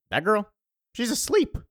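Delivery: high-pitched mock disbelief; incredulous. Acted doubtful man looking at sleeper.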